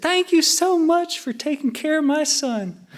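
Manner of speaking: put-on mom's Southern accent, pitched very high; high-pitched, slow Southern mom impression; adorable Southern accent. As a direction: imitate a sweet Southern mother, high and drawn out. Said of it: "just an adorable Southern accent".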